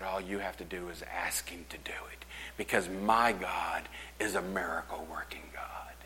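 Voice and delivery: soft, unvoiced whisper; a reverent hush in the church; hushed reassurance. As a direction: reassure quietly, almost breathless.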